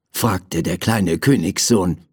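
ordinary delivery, nothing marked

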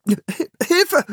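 high, startled cry for help; frightened call. A narrator's reading. Boy hanging as a dog licks him, frightened cry. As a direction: yelp it in fright, pitched high. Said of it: "rief Philipp erschrocken".

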